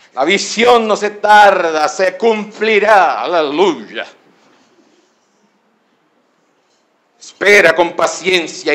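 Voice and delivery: lightly mocking prosperity-pastor act; mock anointed preaching with punched phrases; prosperity preacher. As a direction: imitate a prophesying pastor, drawing out big words. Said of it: "cogen los pastores de la prosperidad".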